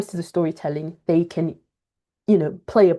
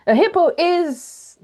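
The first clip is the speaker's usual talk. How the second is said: a teacher giving the word away too soon; announcing the word; 4 semitones higher than usual. Shown as a demonstration. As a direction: show the wrong way of just telling them the word. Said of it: "Don't say".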